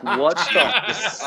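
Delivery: high-pitched laughing astonishment; retold stunned outburst; baffled disbelief. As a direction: blurt it high, half laughing. Acted disbelief at the coincidence.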